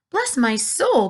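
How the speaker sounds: high, slow amazed exclamation; astonished cry. A narrator's reading. Fire chief amazed at the little boy. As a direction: gasp it in disbelief. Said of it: "cried the head of the fire department".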